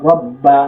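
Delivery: "রব বা" with a held double ব; firm doubled consonant. Shown as a demonstration. Teacher correcting the light reading, firmly doubled ব in "রব বা". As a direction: grip the ব before releasing "বা" in "রব বা". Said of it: "শক্ত করে ধরতে হবে".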